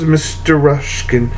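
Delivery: ordinary delivery, nothing marked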